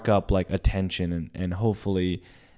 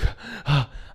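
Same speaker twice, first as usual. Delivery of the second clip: quick high gasp; acted running gasp; 9 semitones higher than usual. Acting out running to her.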